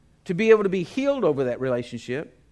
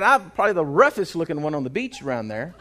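ordinary talk, nothing marked

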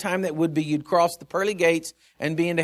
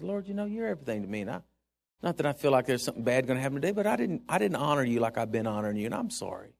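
humble apology prayer; 5 semitones lower, 9 dB quieter, faster than usual. Retold or imitated. Retold repentant prayer.

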